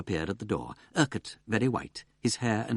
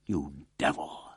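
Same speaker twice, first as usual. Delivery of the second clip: hissed curse; 6 semitones lower, 3 dB quieter, much slower than usual. Acted. Accused man's outburst.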